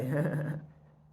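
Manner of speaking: loud high scoffing laugh; mocking laugh. Buyer laughing at the seller's request, loud and scornful.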